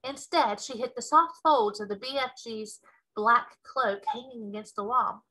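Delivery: plain reading voice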